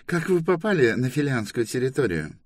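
high official's question; interrogating inspector. Acted. Inspector asks Торрен where they come from, officious.

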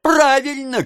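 very high, drawn-out call; shouting from above. A narrator's reading. Gawker shouting from attic window, approving call. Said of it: "крикнул из окна на чердаке".

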